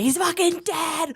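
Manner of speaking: high-pitched retold scream into the phone; imitated high, drawn-out wail; mock scream. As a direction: screech it slowly, hammed-up hysterics.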